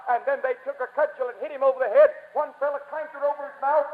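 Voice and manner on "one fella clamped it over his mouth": ordinary talk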